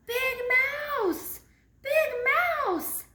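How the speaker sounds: high, loud scared yell; frightened yell. Acted. Frightened little mouse yelling for Big Mouse. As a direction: yell for help, terrified. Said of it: "he yelled".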